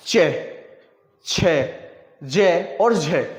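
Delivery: each syllable drawn out slowly, with pauses between; slow sound demo. Shown as a demonstration. Teacher showing children how to say the sounds, slowly. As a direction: say चे, छे, जे, झे one by one, very slowly, to show the friction. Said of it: "जैसे हम बोलेंगे".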